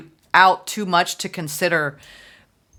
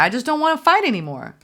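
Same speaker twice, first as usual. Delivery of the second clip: higher-pitched, tired of the fighting; a quoted voice, higher, worn out with conflict; weary and fed up; 9 semitones higher, 3 dB louder, faster than usual. Retold or imitated. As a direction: voice the one who is done fighting, pitched higher. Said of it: "I even hear that".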